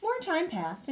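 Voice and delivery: ordinary delivery, nothing marked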